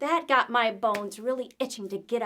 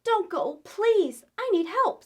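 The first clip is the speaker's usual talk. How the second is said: pleading call; 8 semitones higher, 7 dB louder, slower than usual. Acted. Skelly pleads with children.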